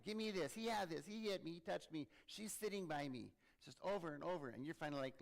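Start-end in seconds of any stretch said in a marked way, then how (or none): 0.0-3.3 high, loud back-seat bickering; squabbling kids. Acted. Kids fighting in the back of the car, loud squabbling. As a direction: tattle loudly like kids fighting. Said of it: "They're fighting".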